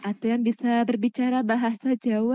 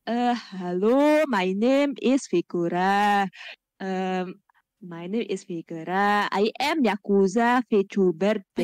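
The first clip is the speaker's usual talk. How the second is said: lower, slow English in a thick Javanese accent; 7 semitones lower, slower than usual. Shown as a demonstration. Streamer introducing himself on request in a Javanese accent. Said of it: "pakai bahasa Inggris tapi aksen Jawa".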